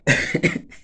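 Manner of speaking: a short, high, breathy laugh. Real, not acted. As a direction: laugh to yourself. Laugh alone.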